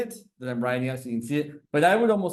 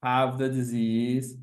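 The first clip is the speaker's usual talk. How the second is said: ordinary delivery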